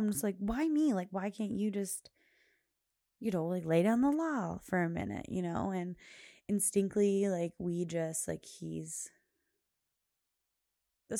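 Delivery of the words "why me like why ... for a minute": exasperated complaint. Retold or imitated. Mom grumbling to herself about her husband, exasperated. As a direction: replay an inner why-me protest, pitched up. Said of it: "saying to myself".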